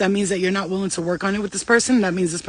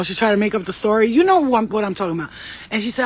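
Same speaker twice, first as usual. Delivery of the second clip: ordinary talk, nothing marked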